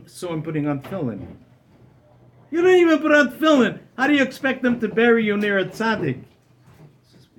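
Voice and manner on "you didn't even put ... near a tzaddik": mocking disbelief. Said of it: "I began laughing in his face".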